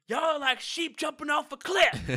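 high, loud, slow scolding shout; coach shouting at class. Retold or imitated. Imitating the coach shouting at laughing students. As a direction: bark it at the noisy class. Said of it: "he would turn around and be like".

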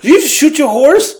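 high, loud squeal of disbelief; shocked child. Retold or imitated. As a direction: squeak it out, stunned. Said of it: "Gigi goes".

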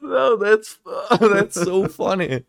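high squeal, then laughing words. Real, not acted. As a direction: crack up mid-sentence. Laughing at the mix-up.

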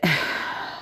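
long soft exhale; weary sigh. Real, not acted. Worn out by exhausting arguments, weary sigh. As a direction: let out a tired, drawn-out sigh. Tired of it all.

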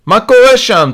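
high, loud shouted question; shouting across. A narrator's reading. Yaron shouts from the floor opposite, shouting. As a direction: holler it to someone far off. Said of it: "צועק אליו ירון".

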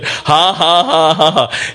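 a lightly voiced grandmother's gloating laugh; mock gloating laugh. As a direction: chuckle like a mother whose prophecy came true. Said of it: "And I know she's thinking".

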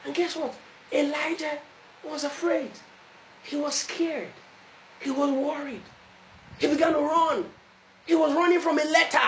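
high, mounting sermon delivery; preaching. Acted. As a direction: preach it in short, high phrases with pauses.

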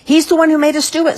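loud, high, rushed accusation; accusing outcry. A narrator's reading. Gardeners blame Mister Grape, shrieking it out. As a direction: blurt it out high, fast and loud.

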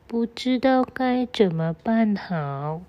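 ordinary delivery

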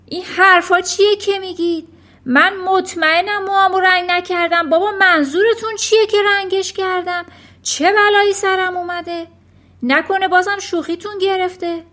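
high, loud protest; alarmed, outraged father's cry; indignant shouting. A narrator's reading. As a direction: yell back in baffled denial. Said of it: "فریاد کشید".

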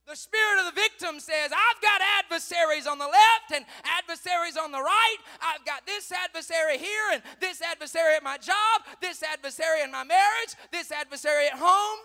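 high, loud sermon cry; full-force preaching. Acted. Preacher voicing the victim's complaint, full-force preaching. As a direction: pile up the list at full volume.

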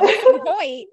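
high, incredulous protest; playful disbelief. Pretend. Co-host challenging friend over missing Hoyt, playful disbelief. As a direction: push the pitch way up in mock exasperation.

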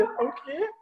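high-pitched disbelieving query; dismayed mock question. Imitating friend told there is no milkshake, dismayed question. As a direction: ask it in squeaky mock dismay.

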